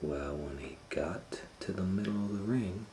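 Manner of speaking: hushed, leaning-in whisper; suspense whisper. A narrator's reading. Telling children the bullfight story, whispering before the twist. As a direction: drop to a whisper to build suspense.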